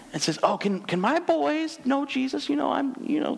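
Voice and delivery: put-on sweet old lady. Mother pleading to Jesus for her boys, sweet old lady. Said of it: "her sweet old lady talk".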